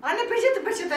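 high child's plea, lightly voiced; mother imitating her daughter asking for a tissue; daughter's voice. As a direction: ask again like a little girl wanting a tissue. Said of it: "Biliyor koynumda peçete olur".

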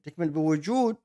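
usual speaking voice

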